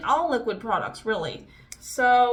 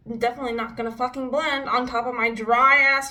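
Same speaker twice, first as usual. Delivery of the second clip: usual speaking voice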